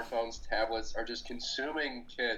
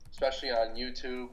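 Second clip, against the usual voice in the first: normal talking voice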